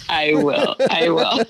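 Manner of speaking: loud laughing promise. Real, not acted. Guest answering the host's fond goodbye, laughing. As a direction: say it loudly through a real laugh.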